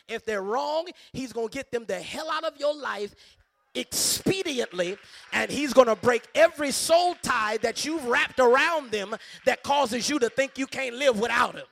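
real full-force pulpit shout; shouted preaching. Real, not acted. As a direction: build to a very loud preaching climax. Preacher climaxes advice to singles.

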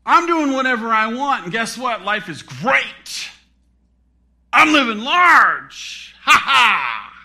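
loud, high, cocky mock swagger; hammed-up gloating shout; mocking boast. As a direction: boast like a scoffer, ending in a big fake laugh. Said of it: "that's mocking God".

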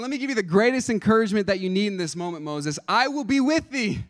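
lightly voiced God promising Moses; God reassuring Moses. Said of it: "God was saying".

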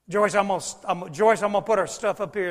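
loud, quick call to his wife. Retold or imitated. Pastor calling to his wife while stowing luggage, loud. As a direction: call across the aisle to Joyce.

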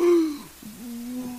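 big mock gasp; shocked gasp. Onlookers reacting to the woman, mock gasp. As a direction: imitate a scandalized crowd's sharp intake. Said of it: "some people going".

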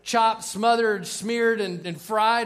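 loud, high mock order call; imitated order call. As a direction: call it out as if to a line cook. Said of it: "when they call it out to the".

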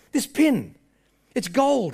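mild distraught realisation, voice lifted; breaking down. Retold or imitated. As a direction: act out growing regret. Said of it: "he's starting to break down".

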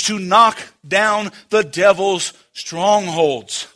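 emphatic, punched preacher's delivery; full-force preaching. Acted. Preacher exhorting church to spiritual warfare, emphatic. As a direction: drive each phrase home like a preacher.

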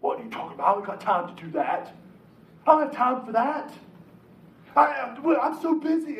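grumble, then blow up as the busy husband; huffy husband. Retold or imitated. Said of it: "you got all huffy".

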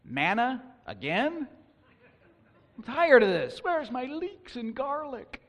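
high, whiny complaining voice, drawn out at the end; mock the grumbling of the people, slow and sulky; whining Israelites. Preacher acting out Israelites complaining about manna, mock whining. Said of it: "in the desert about their lot".

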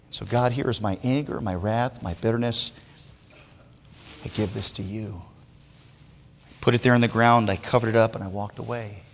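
low, soft letting-go prayer; a hushed giving-up of anger to God; quiet surrender. Retold or imitated. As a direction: hand it over softly, almost spent.

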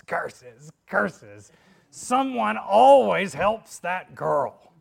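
thwarted witch cursing, building loud; Wicked Witch. Acted. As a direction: rage slowly as a foiled witch. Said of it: "the Wicked Witch says".

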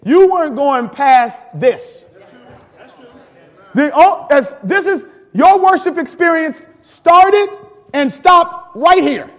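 loud, high, drawn-out emphatic preaching; full-force preaching. Real, not acted. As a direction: proclaim it to the congregation, slowly and loudly.

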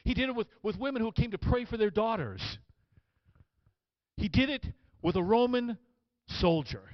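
high, loud sermon storytelling; fired-up preaching. Acted. Preacher introducing the Roman soldier, impassioned preaching. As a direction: build the story with fervor. Preacher retells at full force.

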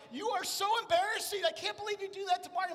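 high, loud mock-scolding wife; wife scolding him in the store, high and loud. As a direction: squeal it like an embarrassed wife. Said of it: "my wife is like".

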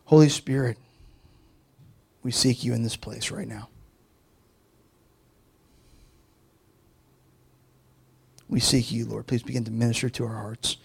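soft, low, hushed prayer. Acted. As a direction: pray quietly and earnestly over the room. Leads prayer.

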